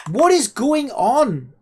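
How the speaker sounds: high, loud, slow bewildered shout; exasperated yell. Real, not acted. Streamer shouting at the game that won't obey, bewildered. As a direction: yell it out in disbelief.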